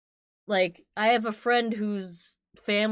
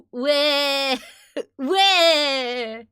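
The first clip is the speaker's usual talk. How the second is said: mock crying with an accent; 3 semitones higher, 5 dB louder than usual. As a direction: fake wailing "weh", louder and higher. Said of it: "It's like crying with an accent".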